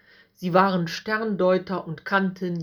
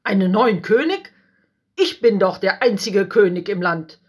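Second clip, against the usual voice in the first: loud, outraged protest; threatened king raising his voice; indignant king; 2 semitones higher, 7 dB louder than usual. A narrator's reading. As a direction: insist nobody else is king. Herodes alarmed, indignant.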